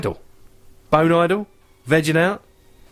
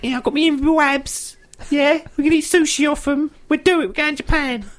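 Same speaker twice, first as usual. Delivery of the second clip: much higher, gushing pop star; girly party voice. Pretend. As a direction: play a giddy celebrity planning a party. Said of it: "she's like".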